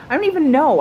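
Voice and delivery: I don't even know, thrown up high and loud; exasperated high laugh. Real, not acted. Vlogger laughing at her own confusion, loud and high. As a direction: toss it off, laughing at yourself. Gives up on the Twitter icon.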